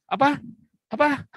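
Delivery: high-pitched, puzzled "what?"; imitating his wife. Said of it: "sampai istri saya bilang". (menirukan istri bertanya dengan nada tinggi)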